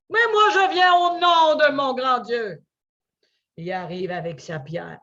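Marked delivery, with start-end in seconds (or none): 0.1-2.6 defiant shout. Acted. David defies Goliath.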